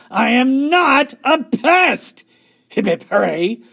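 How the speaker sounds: loud, high, drawn-out protest; indignant yell. A narrator's reading. Little sister denies being a pest, indignant yell. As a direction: yell back in slow, outraged denial. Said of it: "yelled Ramona".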